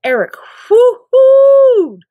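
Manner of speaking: ordinary delivery, nothing marked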